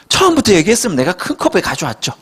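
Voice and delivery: ordinary talk, nothing marked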